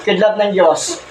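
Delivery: ordinary talk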